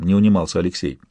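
ordinary delivery, nothing marked

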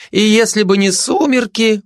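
high-pitched gossip voice; high, insistent chatter; emphatic agreement. Acted. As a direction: carry on emphatically, voice lifted high. Gossip continues, breaks off.

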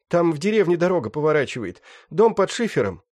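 high-pitched rough bandit; young thug voice. Acted. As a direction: whine it like a wounded gangster. Раненый бандит. (высокий голос молодого бандита)

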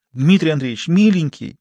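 high, wheedling appeal; tearful pleading. A narrator's reading. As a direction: coax the boss through drying tears. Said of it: "медсестра вытерла глаза носовым платком".

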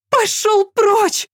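high slow piercing shriek. A narrator's reading. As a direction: screech every word, drawn out. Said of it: "завизжала Яна".

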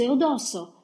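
high anguished call, dropping to normal volume; fading desperate cry. A narrator's reading. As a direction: call his name again as the voice gives way. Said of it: "continuava a gridare". (grido disperato che si spegne)

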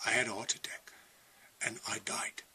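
breathy whispered confession of dying; hushed whisper. Retold or imitated. Testifying to listeners how he died, whispering. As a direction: drop to a bare whisper as if reliving it. Recalls dying of heart attack.

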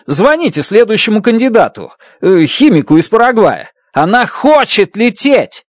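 high, agitated defiant voice; heated refusal. Acted. Grace refuses to fly, heated. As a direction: throw his fear back at her. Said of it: "закричал я".

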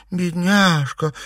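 slow, high cooing sympathy; gushing pity. A narrator's reading. Girl pitying a homeless boy, gushing sympathy. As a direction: draw it out in exaggerated pity. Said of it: "всплеснула руками Серафима".

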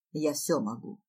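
low, controlled put-down; cutting her off. Acted. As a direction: interrupt with low, cold certainty. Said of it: "обрывает он".

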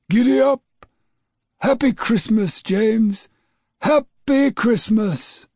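high, loud, slow sleigh call; jolly Father Christmas shout. A narrator's reading. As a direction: call out to the reindeer joyfully. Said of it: "he heard from outside".

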